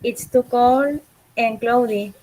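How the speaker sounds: ordinary talk, nothing marked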